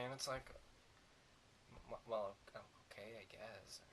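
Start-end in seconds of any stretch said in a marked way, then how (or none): 1.9-3.8 much softer, faltering well okay; a sheepish, barely heard concession; mock hesitant mumble. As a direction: trail off unsure and hushed. Hesitant reply to the movie, voice dropping.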